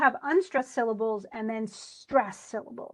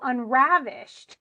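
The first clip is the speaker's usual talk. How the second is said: unravished, slow, with a loud punch on RAV; stress on RAV; 7 dB louder, slower than usual. Shown as a demonstration. Teacher asking student to hear the stress, RAV emphasized in unravished. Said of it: "you can hear me kind of stress it".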